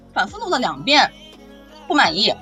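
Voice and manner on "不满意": usual speaking voice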